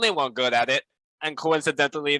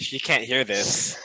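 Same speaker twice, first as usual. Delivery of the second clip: ordinary delivery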